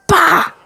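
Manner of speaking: explosive shouted slap sound effect; imitated slap. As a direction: punch out a loud impact noise.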